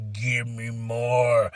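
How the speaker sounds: slow, low, savoring plea; drawn-out relish. Retold or imitated. Fan wanting Brons versus Usos, savoring slowly. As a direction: stretch each word, low and soft.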